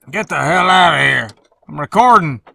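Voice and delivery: annoyed loud shout; yelling at a car. Real, not acted. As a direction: shout him off, irritated. Yells at passing car.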